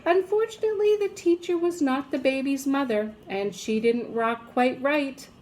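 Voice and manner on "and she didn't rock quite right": ordinary delivery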